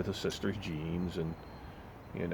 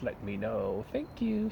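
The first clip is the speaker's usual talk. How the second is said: high, prissy mock scolding, ending on a shrill "Thank you"; mock the rude barista's fake-polite telling off; snotty coworker; 6 semitones higher than usual. Rude barista scolding the young coworker, high mocking impression. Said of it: "She was being a fucking bitch".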